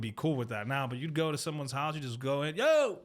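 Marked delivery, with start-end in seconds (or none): 2.6-3.0 high, sing-out greeting; calling out arrival. Acted. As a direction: announce yourself walking into the house. Walking into someone's house, announcing himself.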